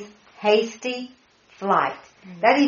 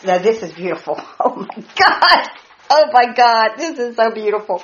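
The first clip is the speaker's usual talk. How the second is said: delighted; 3 dB louder than usual. Real, not acted. Delighted by the verse.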